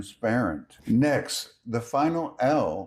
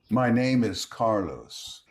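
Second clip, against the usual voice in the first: usual speaking voice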